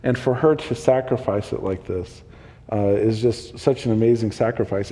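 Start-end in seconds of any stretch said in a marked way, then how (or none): none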